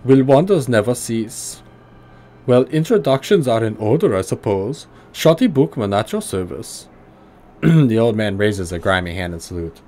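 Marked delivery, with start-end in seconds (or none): 0.0-6.9 put-on gruff German accent, pitched higher. Old tinkerer greeting the player in a higher gruff German accent. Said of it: "heavily accented German tonality".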